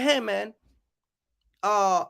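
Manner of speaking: ordinary talk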